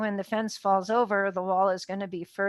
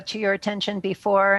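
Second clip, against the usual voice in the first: ordinary delivery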